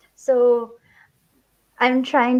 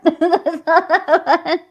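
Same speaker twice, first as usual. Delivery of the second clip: laughing; 4 semitones higher, 4 dB louder, slower than usual. Real, not acted. Laughing repeat of the correction.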